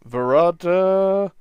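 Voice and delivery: loud slow word hidden in a cough. Retold or imitated. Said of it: "he just kinda coughs it".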